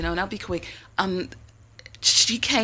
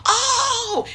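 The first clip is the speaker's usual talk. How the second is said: surprised. Retold or imitated. Caller realizing what the bill was, high mock surprise. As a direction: squeal it like the penny just dropped.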